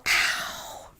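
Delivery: soft, voiceless mock moan of pain; hushed, put-on wince; mimicked ow of pain. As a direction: breathe out a faint imitation ow. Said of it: "He'll go".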